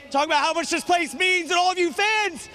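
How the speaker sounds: high, elated shout to a crowd; real excitement. Real, not acted. Winner thanking all the fans at Daytona, overjoyed. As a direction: build to a high, heartfelt victory cry. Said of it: "We love your excitement".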